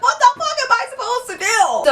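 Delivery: high-pitched exasperated wail; mock panic. Girl stranded at a chaotic party, mock panic. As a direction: wail it, totally at a loss.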